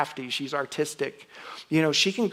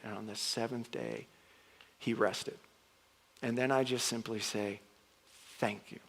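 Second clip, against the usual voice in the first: much softer, reverent prayerful reading; quiet recitation; 3 semitones lower, 6 dB quieter than usual. Retold or imitated. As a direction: recite it hushed as the sun goes down. Reciting Exodus to open Sabbath.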